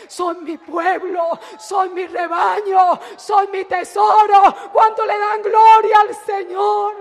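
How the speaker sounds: loud, high, driving sermon climax; preaching at full force. Acted. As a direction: thunder the promise to the congregation. Preacher builds to the shout. (predicación a todo pulmón)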